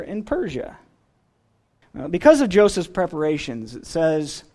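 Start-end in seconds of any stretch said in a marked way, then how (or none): none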